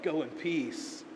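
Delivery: soft and slow; gentle blessing. Acted. Jesus blessing the healed woman, soft and tender.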